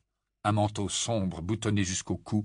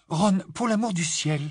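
half serious half amused; 9 semitones higher than usual. A narrator's reading. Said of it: "moitié sérieuse, moitié amusée".

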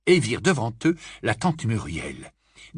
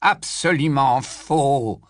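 slow insistence; 3 semitones higher, 4 dB louder, much slower than usual. Acted. Dodge insisting slowly.

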